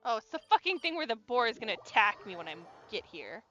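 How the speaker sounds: ordinary talk, nothing marked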